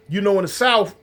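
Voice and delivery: ordinary delivery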